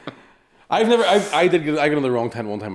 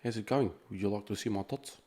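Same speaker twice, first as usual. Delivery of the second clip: put-on rough go at a New Zealand accent; 8 dB quieter than usual. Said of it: "That's how they talk in New Zealand".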